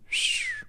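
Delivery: sharp hiss through teeth; whistle through teeth. Acted. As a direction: whistle in disbelief.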